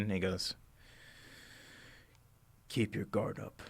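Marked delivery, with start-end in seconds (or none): 2.7-3.6 wary warning. Acted. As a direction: quietly warn while watching for danger. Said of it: "kind of surveying the scene".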